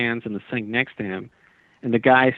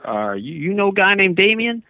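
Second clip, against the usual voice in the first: put-on Southern accent; 8 semitones higher than usual. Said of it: "this guy with a Southern accent".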